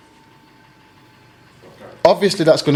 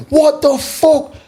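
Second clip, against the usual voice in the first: angry outburst; 9 semitones higher than usual. Retold or imitated. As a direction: explode at the caller. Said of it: "Like, I can't say".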